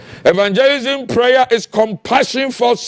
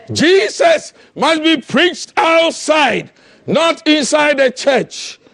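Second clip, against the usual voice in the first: higher, slow, ringing proclamation; slow preached declaration; 4 semitones higher than usual. Acted. Preacher declaring Jesus must go outside, ringing. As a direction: proclaim it slowly to the crowd.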